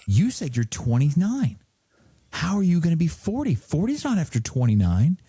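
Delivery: mock outraged child protesting; a hint of a pouting daughter; indignant kid voice. Angry kid voiced in story.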